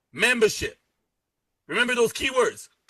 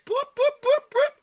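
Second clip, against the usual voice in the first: silly boops. Pretend. Mocking scared prospects backing off.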